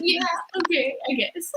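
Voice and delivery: soft, unimpressed retold reply; underwhelmed. Replying to an obsessed sister, underwhelmed. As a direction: go along with it quietly, without enthusiasm.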